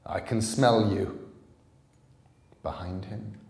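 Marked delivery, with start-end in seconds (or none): 0.1-1.3 slow, louder predatory threat; menacing hunter. Acted. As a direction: savor the threat like a tiger. Killer hunting the boy.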